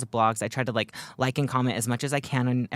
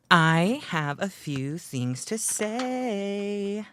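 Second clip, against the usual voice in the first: slow, high, stretched-out declaration; theatrical speech opener; mock-grand announcement; 8 semitones higher, much slower than usual. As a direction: announce it grandly, holding the last word. Mock-grand start of acceptance speech.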